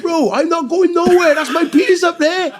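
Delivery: high, loud and slow refusal; stubborn shout. Retold or imitated. The buyer refusing to leave, shouting. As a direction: dig in and refuse loudly. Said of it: "He said".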